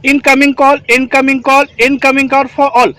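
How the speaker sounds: steady, clear radio call-out; radio call demo. Shown as a demonstration. Trainer demonstrating a radio call to the team, clear call-out. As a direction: speak it into the walkie-talkie like an operator. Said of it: "दबाने के बाद".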